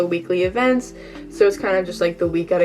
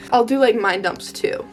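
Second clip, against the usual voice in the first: ordinary talk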